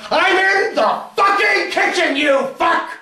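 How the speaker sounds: high, loud, drawn-out scream; enraged full-force shout; furious calling out. Acted. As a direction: explode at them across the house. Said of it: "I call out".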